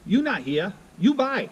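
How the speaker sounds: put-on bossy little waitress in a Thai accent; mock Thai accent, insistent. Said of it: "And she said".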